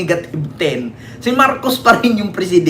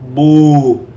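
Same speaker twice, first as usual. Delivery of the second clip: a single loud mocking "boo!", far louder than the talk; 5 semitones lower, 5 dB louder than usual. Vlogger jeering at Sara's supporters with one loud boo. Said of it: "isa lang masasabi ko".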